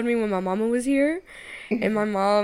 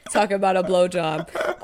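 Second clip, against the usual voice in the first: ordinary talk, nothing marked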